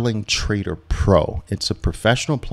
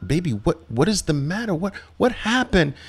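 higher, gentle worried questions; worried dad; 9 semitones higher than usual. Retold or imitated. Father to crying daughter, high gentle concern. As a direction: ask your little girl what's wrong. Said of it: "And I'm like".

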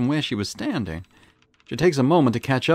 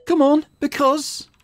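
high, slow, taunting "Come on, because"; mocking taunt; 4 dB louder, slower than usual. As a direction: draw it out to mock her. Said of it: "An insulting challenge".

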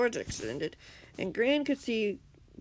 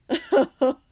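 loud, high mocking laugh; villain laugh; 6 semitones higher, 10 dB louder than usual. A narrator's reading. Villain scoffs at being challenged, loud laugh.

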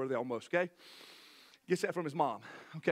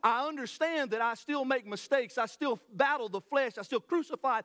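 building sermon; 5 semitones higher than usual. Acted. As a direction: drive the point home from the pulpit. Preaching builds to full force.

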